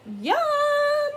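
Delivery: very high, loud yum; delighted. Acted. Cats getting their milk at last, delighted. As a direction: relish it out loud.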